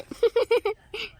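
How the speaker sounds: high-pitched teasing giggle; mocking laugh. Friend accusing her of making a scene, high mocking laugh. Laughing at suspected fake crying.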